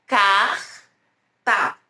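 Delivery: "carta" with the R scraped long in the throat, then ta; R de garganta. Shown as a demonstration. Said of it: "é esse R". ("carta" com R raspado na garganta, pausa antes do ta)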